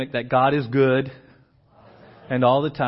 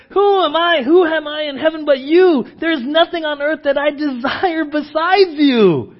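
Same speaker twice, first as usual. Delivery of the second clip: a lifted cry of devotion, loud and high; Asaph's cry; 9 semitones higher, 6 dB louder than usual. Retold or imitated. Asaph voiced crying out his devotion to God, loud and lifted. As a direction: cry it out like a man restored. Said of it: "and suddenly he's like".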